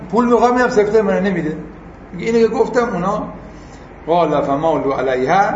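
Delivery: usual speaking voice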